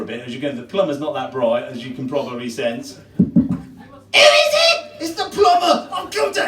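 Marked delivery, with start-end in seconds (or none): 3.2-4.9 very high, loud parrot squawk; parrot shriek. Acted. Parrot shrieking at the plumber. 5.0-6.4 cross plumber. Acted. Plumber shouting angrily through the door at the parrot. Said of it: "it gets really cross".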